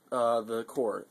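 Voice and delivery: ordinary talk, nothing marked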